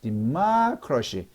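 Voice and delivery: 디마크러시 with 마 leaned on, slow; stress on 마. Shown as a demonstration. Teacher models stress on 마 for the class. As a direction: hit 마 in 디마크러시. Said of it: "마의 액센트가 있으니까".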